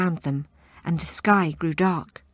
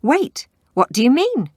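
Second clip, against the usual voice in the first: higher, louder demand; 8 semitones higher, 5 dB louder than usual. Acted. Banquo demands an answer from the witches, loud. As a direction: press them for an answer. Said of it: "demanded Banquo".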